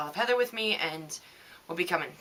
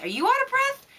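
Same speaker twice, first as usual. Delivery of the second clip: squeaky mock question; 6 dB louder than usual. Teasing question to listeners.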